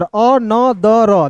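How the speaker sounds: अ न द र very slowly, each sound apart; drawn-out separate syllables in अ न द र; sounded out letter sounds. Shown as a demonstration. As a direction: sound out अ, न, द, र one by one. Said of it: "देखिए यहाँ पे".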